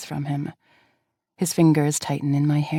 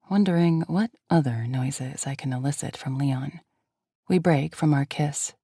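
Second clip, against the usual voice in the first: ordinary delivery, nothing marked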